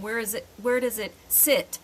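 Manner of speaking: usual speaking voice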